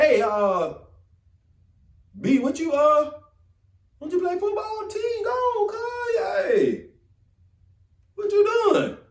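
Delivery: high mocking shouts from the team; jeering teammates calling out. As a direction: tease him loud and high, like the guys in the caf.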